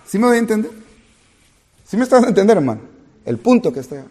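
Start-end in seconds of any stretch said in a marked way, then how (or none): 0.1-2.8 preacher's rhetorical check. Acted. Pastor checking the congregation.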